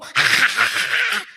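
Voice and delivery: high open-mouthed cackle, mocking his laugh; imitated wide-mouthed laugh. Teasing Daddy about how he laughs, imitated laugh. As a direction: throw your head back and copy his big laugh. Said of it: "because you go".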